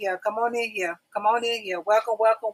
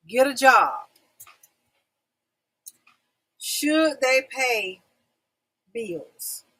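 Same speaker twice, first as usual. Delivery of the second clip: slow, drawn-out title reveal with weighty pauses; topic announcement; 3 dB louder, much slower than usual. Real, not acted. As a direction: announce the topic word by word, very slowly.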